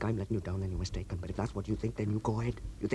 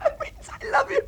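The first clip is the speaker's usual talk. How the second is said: hysterical laugh; 4 dB louder than usual. Acted.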